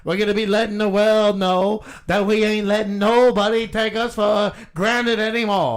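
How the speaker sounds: rising fired-up rally cry; rousing protest speech. Acted.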